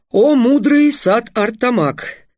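slow, high, drawn-out flattery; flowery courtly address. A narrator's reading. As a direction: lay the praise on thick and slow. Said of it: "продолжил Трикс". (медленная высокая льстивая речь)

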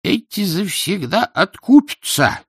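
put-on slow, high elderly grumbler; old person's voice. A narrator's reading. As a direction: drawl it high like a disapproving oldster. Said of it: "старческий".

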